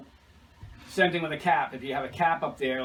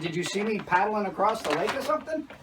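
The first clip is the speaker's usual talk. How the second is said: joking disbelief; 6 semitones higher than usual. Retold or imitated. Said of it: "I said".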